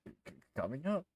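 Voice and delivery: jumpy, stuttered acted fear; frightened bartender. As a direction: stutter it out like a scared barkeep. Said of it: "who is frightened".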